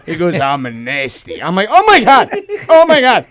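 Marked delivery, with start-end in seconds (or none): 0.4-1.4 put-on low, slow old-dad voice; imitated lecherous father. Voicing his father in a story. 1.7-3.2 loud, raised mock disgust; retold horrified outcry. Acting his horrified reaction to father.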